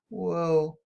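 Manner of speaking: usual speaking voice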